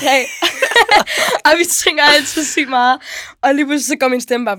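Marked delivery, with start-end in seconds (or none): 0.3-1.4 burst of laughter. Real, not acted. Laughing about lost voice.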